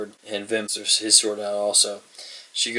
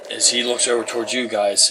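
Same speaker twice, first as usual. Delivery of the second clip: ordinary delivery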